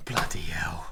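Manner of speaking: exasperated whisper; whispered curse. Real, not acted. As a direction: hiss it to yourself in dismay. Mutters after mouse slip.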